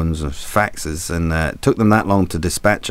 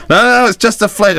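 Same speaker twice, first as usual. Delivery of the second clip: high, loud mock-urgent crewman; excitable high-pitched sailor; crew reassuring captain; 12 semitones higher, 5 dB louder than usual. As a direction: pipe up loudly over the fallen captain. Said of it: "the crew would rush over and they'd say".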